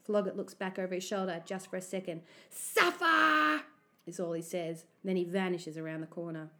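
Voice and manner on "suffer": high, loud jeer; taunting shout. A narrator's reading. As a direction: call it back mockingly. Bully calls back while fleeing.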